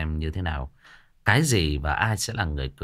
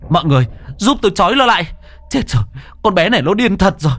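high, loud, fast cries for help; desperate to hold her down; panicked shouting; 6 dB louder, faster than usual. Acted. Hùng begs others to tie up Lan, high loud panic. Man restrains the possessed girl.

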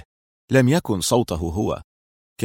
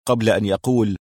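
ordinary delivery

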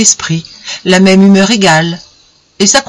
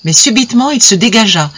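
ordinary delivery

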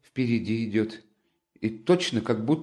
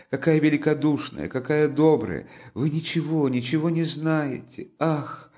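high, anguished pleading; in despair; 8 semitones higher than usual. A narrator's reading. Sonya desperately defends Katerina to Raskolnikov, high and anguished. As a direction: pour it out in despair. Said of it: "проговорила это точно в отчаянии".

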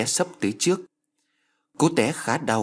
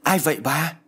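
higher-pitched startled question; crying out; 5 semitones higher than usual. A narrator's reading. Husband finds someone under his wife, crying out. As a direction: exclaim it, pitch lifted. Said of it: "Năm Đực kêu lên".

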